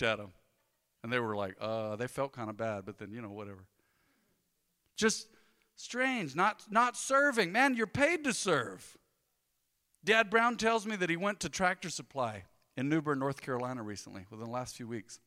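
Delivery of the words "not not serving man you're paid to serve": slow and pointed; slow, insistent rebuke; slow emphatic scold. Real, not acted. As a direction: drag each word out to make the point.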